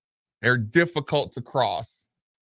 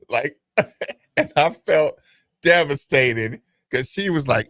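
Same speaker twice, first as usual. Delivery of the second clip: normal talking voice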